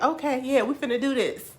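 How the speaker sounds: hyped, building determination; retold eager resolve. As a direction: psych yourself up.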